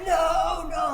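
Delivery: high-pitched pleading refusal; mock-panicked high protest; panicked objection. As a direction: imitate a friend begging against it. Friend objects to turning back.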